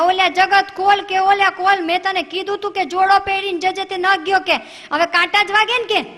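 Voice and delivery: loud, high scolding grandma; frantic calling. Retold or imitated. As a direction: bang on the door and yell. Grandma banging on door. (બારણું ખખડાવતી દાદીની ઊંચા સાદે બૂમ)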